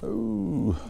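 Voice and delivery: a sudden high ooh as the fish shows; surprised ooh. Real, not acted. Angler sees a fish surface, surprised high ooh.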